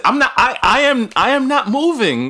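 high, loud defiant refusal; stubborn passenger. Retold or imitated. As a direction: dig in and refuse to budge. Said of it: "Then they say".

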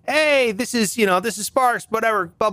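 ordinary talk

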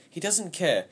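high, loud, desperate outburst; distraught boy near tears; tearful. A narrator's reading. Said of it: "There were tears in my eyes".

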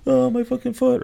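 put-on high, pained cries of a hurt kid. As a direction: wail it like a hurt ten-year-old. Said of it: "I was like".

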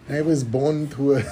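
lightly put-on Spanish accent. Acting out Russell Crowe's audition for the director, mock Spanish accent. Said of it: "let me hear your Spanish accent".

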